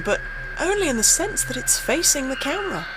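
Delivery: spooked narrator, voice tightening; rising dread. Acted. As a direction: let the fear creep up as you describe it. Describing the figure on video.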